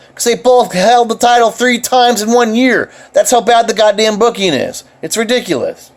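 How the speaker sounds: heated rant, pitched high; getting fired up about bad booking; riled-up fan venting; worked up. Real, not acted. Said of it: "I'm getting worked up".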